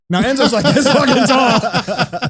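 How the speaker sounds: laughing through the words, slowly; slow and laughing, hard to get the words out. Real, not acted.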